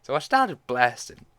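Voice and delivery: gleeful narration. Real, not acted. Narrator continuing the story, gleeful and loud. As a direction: say it with relish, back in her own voice. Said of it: "meme just started playing in my head".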